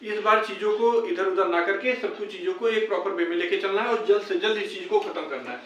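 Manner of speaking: ordinary delivery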